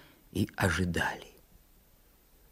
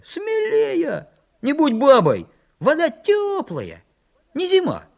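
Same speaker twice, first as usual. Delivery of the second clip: very high, drawn-out egging on; encouraging calls; 4 dB louder, slower than usual. A narrator's reading. Санька goads Митька from the shore, high calls. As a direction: call it out high, goading a timid friend. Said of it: "напутствовал его с берега Санька".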